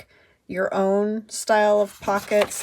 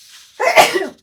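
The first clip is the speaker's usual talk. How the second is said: sudden loud sneeze; sneezing; 13 semitones higher, 13 dB louder than usual. Real, not acted. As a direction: let a real sneeze burst out. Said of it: "I'm gonna sneeze".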